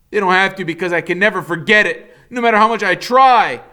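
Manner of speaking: put-on desperate boy. A narrator's reading. Boy pleads with father.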